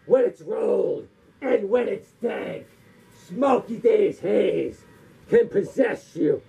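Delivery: slow, drawn-out slogan delivery; slogan recital. Acted. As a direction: stretch each phrase out with pauses. Recites a slogan-like rhyme twice.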